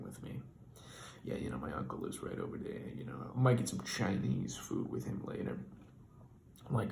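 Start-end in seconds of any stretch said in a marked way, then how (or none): 1.2-5.6 a strange man oversharing in a quiet truck cab; creepy low confiding voice. Retold or imitated. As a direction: lower and softer, sinister calm. Weird driver leveling in truck.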